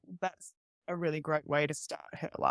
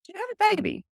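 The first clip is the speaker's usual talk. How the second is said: much higher, astonished question; incredulous visitor; 3 dB louder than usual. Retold or imitated. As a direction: act stunned there's a baby here. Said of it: "people would come over and go".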